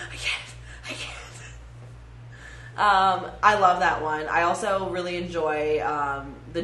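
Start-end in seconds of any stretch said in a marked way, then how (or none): 0.1-1.1 breathless whispered gasp; out of breath. Retold or imitated. Imitating winded character after running, breathless whisper. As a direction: pant it out, winded. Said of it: "He's like".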